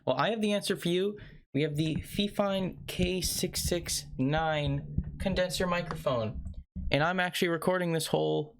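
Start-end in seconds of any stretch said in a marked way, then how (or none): 0.1-4.8 a playful, raised salesman opener addressing the crowd; infomercial pitch. Acted. Host opening his mic review to viewers, hyped announcer pitch. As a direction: sell it like a late-night TV ad.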